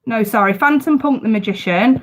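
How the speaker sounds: ordinary talk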